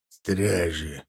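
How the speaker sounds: low hollow boom. Acted. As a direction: say it deep and resonant. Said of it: "гулко произнёс Аркар".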